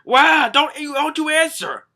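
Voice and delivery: high, loud panicked yell; hammed-up shouted protest; mock yelling. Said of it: "pretty sure it's Usopp yelling".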